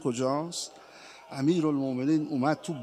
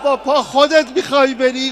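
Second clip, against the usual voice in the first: loud, higher anguished question; alarmed cry; 8 semitones higher, 9 dB louder than usual. Acted. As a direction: shout the question in disbelief. Uncle asks in alarm. (فریاد پرسشی پر از ناباوری)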